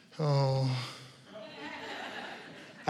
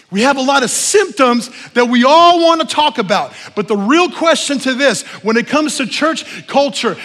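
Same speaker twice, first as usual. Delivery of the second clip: loud, high exhorting preacher; preaching at full force; 8 semitones higher, 8 dB louder than usual. Acted. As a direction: press the question on the church. Preacher builds to full force again.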